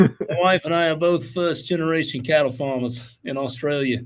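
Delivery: put-on a light, hesitant Australian accent; Crocodile Dundee accent. Host tries Aussie accent reading Les's email. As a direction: try on an Aussie accent for the letter. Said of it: "read it like some Crocodile Dundee".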